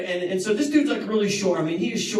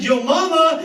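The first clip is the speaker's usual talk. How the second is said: louder, higher your mama jab; mock taunt; 9 semitones higher, 6 dB louder than usual. Kid taunting a bully before a fight, cocky jab. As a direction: fire off the taunt with a grin.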